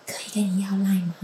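whispered imitation of a shy question; hushed, near-voiceless quote; very soft-spoken. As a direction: ask it under your breath, imitating someone barely audible. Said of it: "他讲得很小声".

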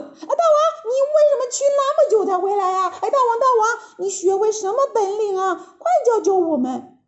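high, fast, giggly monkey chatter; eager chattering monkeys. A narrator's reading. 猴子们围着大王七嘴八舌地问. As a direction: pester the king all at once. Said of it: "七嘴八舌地问".

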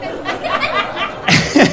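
breathless squeaky giggle; high airy laugh. Real, not acted. Teller laughing at his calm acceptance of death, airy giggle.

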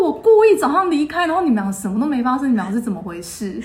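mock outrage at wasted matchmaking; exasperated disbelief. [name] exasperated at the couple.

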